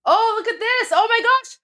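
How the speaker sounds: high, loud delighted exclamation; excited surprise. Real, not acted. Streamer spotting new viewers in the chat, real excited surprise. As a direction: burst out in real amazement.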